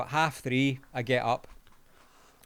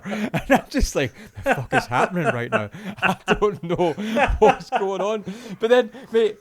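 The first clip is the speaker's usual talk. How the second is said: talking through real laughter; laughing; 7 semitones higher, 6 dB louder than usual. Real, not acted. Storyteller recalling his confusion at the prank, really laughing. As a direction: keep cracking up while retelling your bewilderment.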